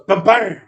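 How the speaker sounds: loud, high two-beat drum noise; imitated drum hit. Game master mimics a drum for the players, loud imitated hit. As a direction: punch out a vocal drum hit.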